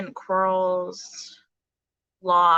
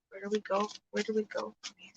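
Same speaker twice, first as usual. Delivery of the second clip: much softer, quicker, to herself; fading mutter; 8 dB quieter, faster than usual. Real, not acted. Reader musing quietly to herself, fading. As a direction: let it fade away under the breath.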